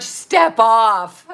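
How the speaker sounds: a lightly voiced sitcom character, loud, with "Step" punched; Seinfeld impression. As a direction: toss off the catchphrase in a loud put-on voice.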